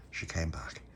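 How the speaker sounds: ordinary delivery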